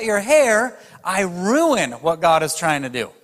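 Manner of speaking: high, loud impassioned sermon; full-force preaching. Acted. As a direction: preach it hard to the crowd.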